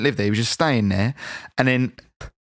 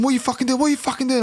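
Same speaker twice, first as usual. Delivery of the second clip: high-pitched, indignant retold protest; acted argument; 11 semitones higher, faster than usual. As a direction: play the woken mate protesting, pitched way up. Acts out quarrel with woken mate.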